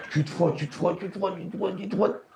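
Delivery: fading mutter. Retold or imitated. Mock self-rubbing chant, trailing.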